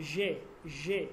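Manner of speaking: জে said as "zhe", like the middle of "vision"; teacher showing জে with an English "vision" zh; zh sound in জে. Shown as a demonstration. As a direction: model জে with a long zh at the start. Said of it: "এইটার উচ্চারণ হচ্ছে".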